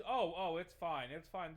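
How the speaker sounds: loud opening, easing off quickly; mock dismissive reassurance. Streamer mimicking politicians on homelessness, mock breezy reassurance. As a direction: brush it off in a mocking imitation.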